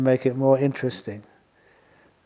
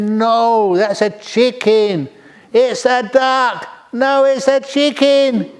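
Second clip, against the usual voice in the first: higher, louder, slow quarrelling back and forth; the squabble peaks, both sides pushing hard; heated argument; 9 semitones higher, 4 dB louder, much slower than usual. Acted.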